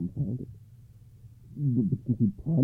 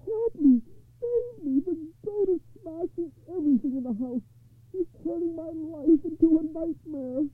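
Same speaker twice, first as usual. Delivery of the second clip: high-pitched desperate poor man; wailing. A narrator's reading. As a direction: wail for help in a high voice. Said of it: "he wailed".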